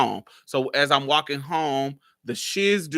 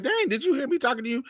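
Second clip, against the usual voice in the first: quick, higher-pitched mild scolding; non-hostile complaint; 12 semitones higher, faster than usual. Retold or imitated. Stepdad asks the boy ignoring him, mildly put out. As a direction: voice a stepdad's exasperated but harmless question. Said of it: "He's not, like, bitching at me".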